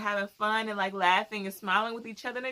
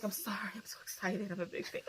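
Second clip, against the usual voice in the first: flustered whisper; 11 dB quieter than usual. Retold or imitated. Imitating flustered assistant.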